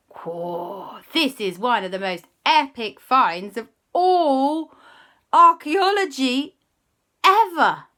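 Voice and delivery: loud, slow, wide-eyed awe; booming awe at the fossil; amazed excitement. Acted. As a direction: marvel at the most epic find. Amazed dinosaur find.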